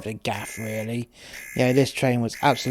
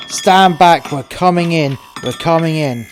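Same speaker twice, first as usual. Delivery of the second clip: loud, raised warning, easing at the end; police shout; 4 semitones higher, 7 dB louder than usual. Retold or imitated. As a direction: shout through the door, then ease off. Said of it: "he said".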